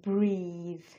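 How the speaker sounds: "ব্রিথ" with a long drawn-out ee; teacher's drawn-out "ব্রিথ"; long vowel demo. Shown as a demonstration. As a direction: stretch the ee in "ব্রিথ" slowly. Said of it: "এটা long".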